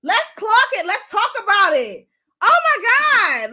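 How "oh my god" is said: slow, louder and higher oh my God; drawn-out exasperation. Real, not acted. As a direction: let the disbelief stretch. Exasperated at the clip.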